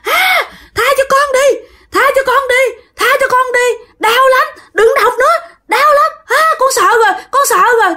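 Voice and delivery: high-pitched, wailing plea for mercy; screaming in pain. Acted. Ghost begs the master to stop, shrill screaming. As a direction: shriek and beg as if burned. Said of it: "Thì lúc đó nó la quá chứ".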